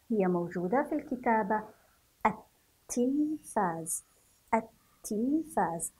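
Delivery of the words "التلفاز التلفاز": التلفاز slowly as at-tilfaaz, no l in the article; silent lam. Shown as a demonstration. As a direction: double the t in التلفاز, dropping the article's l. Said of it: "ولا تنطق". (نطق التلفاز ببطء دون لام التعريف)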